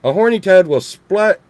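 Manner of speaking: ordinary talk, nothing marked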